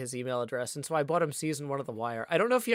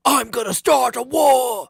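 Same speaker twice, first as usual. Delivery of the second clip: slow, much higher and far louder full shout; rock-star war cry, much higher and louder; shouted lyric quote; 9 semitones higher, 14 dB louder than usual. Retold or imitated. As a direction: yell it like the rock singer. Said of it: "that does sound like the guy from that song".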